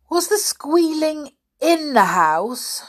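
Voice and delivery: put-on high, loud boy's shout. A narrator's reading. Peter shouting to the deaf caretaker, shouted. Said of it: "shouted Peter".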